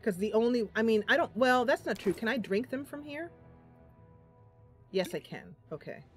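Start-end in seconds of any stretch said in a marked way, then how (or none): none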